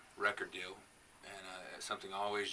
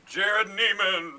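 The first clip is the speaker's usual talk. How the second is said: booming, drawn-out announcer call; fighting game announcer; 12 semitones higher, 16 dB louder, much slower than usual. Acted. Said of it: "I like the Munster truck voice".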